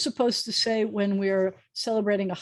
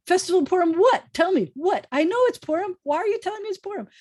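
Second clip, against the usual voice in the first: much higher, louder baffled outburst; mock exasperation; 10 semitones higher, 3 dB louder, faster than usual. Retelling her brother's terse holiday text, in mock exasperation. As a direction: throw up your hands, comically confused. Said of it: "it stressed me out".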